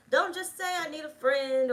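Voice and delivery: high mocking voice; mocking excuse.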